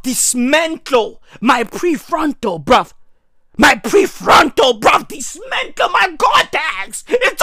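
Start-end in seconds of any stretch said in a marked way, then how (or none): none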